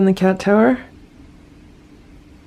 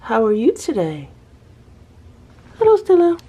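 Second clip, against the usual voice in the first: higher-pitched pet-talk greeting; kediyle konuşma; 6 semitones higher than usual. Real, not acted. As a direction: coo a hello to the cat, pitched up. Greeting her cat.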